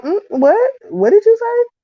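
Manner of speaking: high, incredulous questioning with a sharp rise; affronted challenge. Retold or imitated. Wife challenging her husband who hurt her feelings, affronted. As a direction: act out a sideways-look "excuse me?". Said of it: "I be looking at him sideways like".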